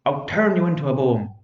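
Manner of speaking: loud, furious repeated threat; shouting witch. Acted. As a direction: shout it while hopping in pain. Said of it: "holding her leg and shouting".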